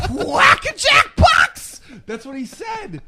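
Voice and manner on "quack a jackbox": very high, loud, slow mock screech; mock shrieking troll. Keemstar finishing the troll's taunt in a screech. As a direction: drag out the taunt in a shrill yell. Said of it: "And he goes".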